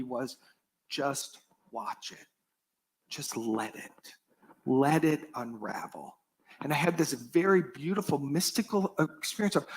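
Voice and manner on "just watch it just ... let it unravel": hushed, slow, low whisper; inner voice whisper. Retold or imitated. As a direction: let the inner voice breathe it slowly. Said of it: "what I heard in me".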